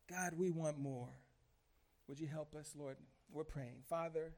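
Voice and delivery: hushed plea to God; prayer. Real, not acted. Pastor asking the Lord for help before preaching, praying softly.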